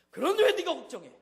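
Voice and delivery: God shouting at a worried pastor; booming voice of God. Retold or imitated. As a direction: thunder the question down from above. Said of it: "더 끈".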